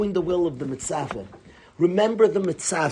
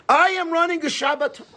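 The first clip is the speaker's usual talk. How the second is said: retold yell; 8 semitones higher than usual. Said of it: "he yells at his son".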